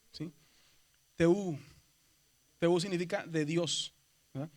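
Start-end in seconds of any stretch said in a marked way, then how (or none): 1.2-1.6 "Teú" con la Ú larga en arco; vocal alargada. Shown as a demonstration. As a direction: estira la Ú de "Teú", sube y baja. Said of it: "en el circunflejo se alarga". ("Teú" con la última vocal sostenida)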